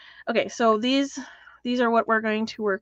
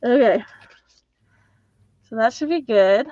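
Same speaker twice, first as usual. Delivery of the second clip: muffled behind a mask; talking through a face mask; 4 dB louder than usual. Real, not acted. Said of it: "if I sound a little muffled".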